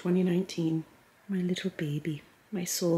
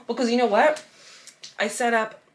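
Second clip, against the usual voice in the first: chewing while talking; mouth full; 4 semitones higher, 7 dB louder than usual. Real, not acted. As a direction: talk through a mouthful. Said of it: "sorry, I'm chewing".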